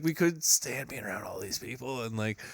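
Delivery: usual speaking voice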